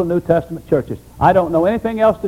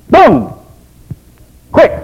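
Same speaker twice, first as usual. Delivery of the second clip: loud, punched exclamation; sudden boom; 9 semitones higher, 8 dB louder than usual. Real, not acted. Preacher warning the congregation, a sudden loud boom. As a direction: slam the word down.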